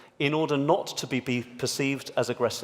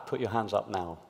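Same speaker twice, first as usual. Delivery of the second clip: ordinary delivery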